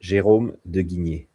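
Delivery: name said with a French accent. Shown as a demonstration. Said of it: "with your French accent?".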